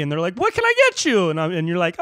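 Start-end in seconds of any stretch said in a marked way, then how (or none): 0.4-1.3 very high-pitched perky server; hammed-up chirpy fast-food voice; too happy. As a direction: take the order with overdone cheer. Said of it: "you're too happy".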